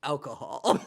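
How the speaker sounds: understated, with a dramatic pause; imitated bartender deadpan. Bartender telling tourists his job, dramatic deadpan. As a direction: drop the excitement, state it plainly and pause. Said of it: "just like he says".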